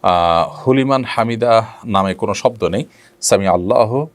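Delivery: usual speaking voice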